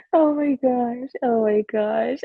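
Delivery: laughing recovery. Real, not acted.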